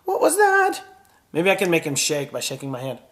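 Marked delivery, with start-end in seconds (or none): 0.0-0.8 high, startled yelp of a question; startled puppet. Acted. Puppet startled by thunder outside, high alarmed cry. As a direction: jump at the sudden thunder.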